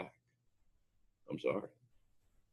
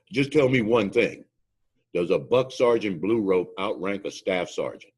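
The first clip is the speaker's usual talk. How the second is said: angry demand; 6 semitones higher, 10 dB louder than usual. Retold or imitated. Instructor storms to the boss.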